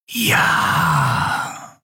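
slow, hushed, distant whisper; cold and menacing, barely voiced; sinister whispering governor. Governor whispering coldly to the admiral for his report.

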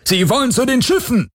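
a high, urgent call of alarm; alarmed shout. A narrator's reading. Guard inside the house warning the others, high urgent shout. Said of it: "hörte er jemanden im Haus rufen".

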